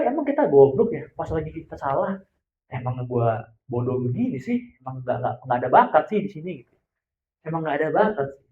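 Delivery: low, soft self-blame, mildly acted; quiet self-doubt. Person telling themselves they have no talent, quiet self-doubt.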